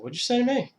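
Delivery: high-pitched indignant movie retort; offended challenge. Retold or imitated. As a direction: act out a touchy tough guy's challenge. Retelling Pesci's challenge in film.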